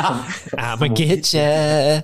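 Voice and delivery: slow creepy drawn-out growl; slow creepy monster voice. Pretend. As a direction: drag it out like a lurking monster. Repeating Striga threat.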